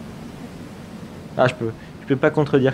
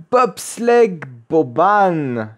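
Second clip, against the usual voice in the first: "Bobsleigh Boban" in a slow, hammed-up German accent; much slower than usual. Vlogger announcing next coaster, mock German accent. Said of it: "avec mon plus bel accent allemand".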